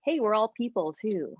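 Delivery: ordinary talk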